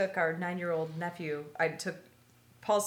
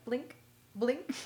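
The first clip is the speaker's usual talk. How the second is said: deadpan blink; 3 semitones higher, 5 dB quieter than usual. Retold or imitated. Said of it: "just looked at him and went".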